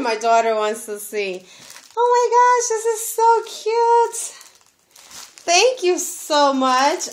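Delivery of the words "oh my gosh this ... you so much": high, loud squealing gush with laughs; thrilled high shriek over the gift; excited squeal. Real, not acted. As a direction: squeal with real delight, laughing.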